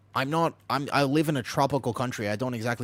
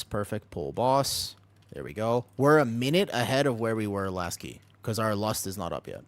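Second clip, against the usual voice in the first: usual speaking voice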